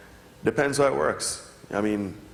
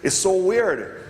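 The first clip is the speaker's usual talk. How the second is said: much higher and slow on is so weird; stunned disbelief; 7 semitones higher, slower than usual. Retold or imitated. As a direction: drag it out, amazed. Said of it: "And you're like".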